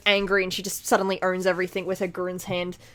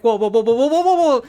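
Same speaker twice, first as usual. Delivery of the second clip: mock-alarmed rapid string of whoas, swelling louder and higher; imitated alarm; 3 semitones higher, 7 dB louder, faster than usual. Retelling C two reacting to a freak-out, mock alarm. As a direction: play a startled attempt to calm things down.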